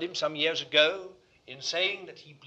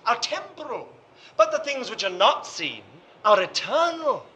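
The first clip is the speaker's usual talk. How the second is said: loud, high-rising preacher's proclamation; impassioned preaching; 6 semitones higher, 11 dB louder than usual. Real, not acted. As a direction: preach it with swelling force. Preaches at full force.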